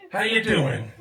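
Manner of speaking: imitating a friend's cheery Chicago, Illinois greeting; Steve's Chicago greeting. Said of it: "that's how Steve would always greet me".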